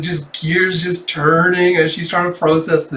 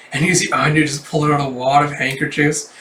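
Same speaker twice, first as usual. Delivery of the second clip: usual speaking voice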